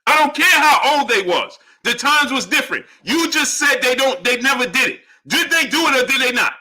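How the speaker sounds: high, heated argumentative voice; exasperated insistence. Real, not acted. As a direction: hammer the point at a stubborn viewer.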